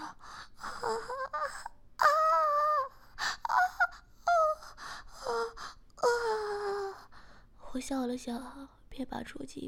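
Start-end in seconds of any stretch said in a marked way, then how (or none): 0.0-7.1 rising moans. Acted. Heroine cries out in climax.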